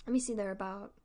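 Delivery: ordinary talk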